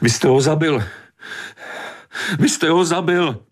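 high, piercing repeated accusation; shrieked accusation. A narrator's reading. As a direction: drive each accusation home in a high cry. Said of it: "Ječela".

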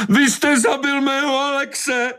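drawn-out, very high scream; grief-stricken mother shrieking an accusation; anguished cry. A narrator's reading. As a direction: cry out slowly at the top of the voice. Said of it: "Vykřikla".

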